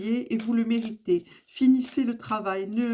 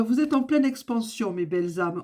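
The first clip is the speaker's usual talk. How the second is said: usual speaking voice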